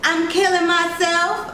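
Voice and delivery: high, slow, mock-tragic little girl; dramatic child. A narrator's reading. Girl announces to the driver she is dying, slow and hammed-up. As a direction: ham up the despair like a child actress. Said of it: "Isis declared dramatically".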